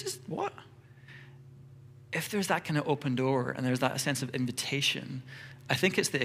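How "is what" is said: baffled squeak. Pretend. Friend baffled by Jesus.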